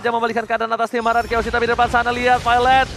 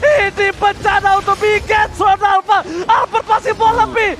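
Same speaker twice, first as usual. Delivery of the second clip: fighting game announcer; 7 semitones higher than usual. Acted. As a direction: call the teamfight at full hype. Esports caster hype call.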